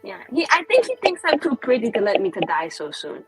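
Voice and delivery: ordinary delivery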